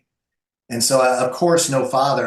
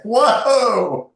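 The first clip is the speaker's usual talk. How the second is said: much higher, louder drawn-out whoa of disbelief; stunned whoa; 11 semitones higher, 7 dB louder than usual. Retold or imitated. Guest recalling hearing that the shouting was normal, stunned and much higher. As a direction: let the shock spill out in one long word. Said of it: "And I was like".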